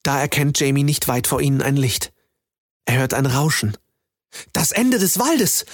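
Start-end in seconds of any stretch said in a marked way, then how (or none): 4.5-5.7 high, slow excited cry; excited call. Acted. Boy spots the forest's end, high excited call. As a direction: call out the find with joy. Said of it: "ruft er aus".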